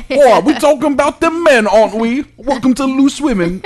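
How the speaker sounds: high, loud mock British TV host accent. Co-host hamming a British accent for Loose Women. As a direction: camp it up as a British talk show host. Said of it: "Let me do British accent".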